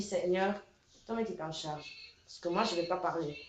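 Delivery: voix basse et plus grave; prière confiante rejouée. Retold or imitated. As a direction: remets l'affaire à Dieu, tout doucement. Confie l'affaire à Dieu en prière. (prière confiée à mi-voix)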